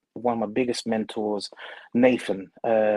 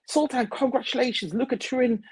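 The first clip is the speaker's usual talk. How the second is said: excited congratulations; 11 semitones higher than usual. Retold or imitated. Acted excited congratulations from customers.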